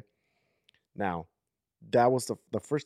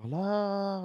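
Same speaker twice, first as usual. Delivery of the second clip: yelling; 11 semitones higher than usual. Retold or imitated. In the stormy dream, yelling toward the crying girl. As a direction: call out again, searching. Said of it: "I'm yelling".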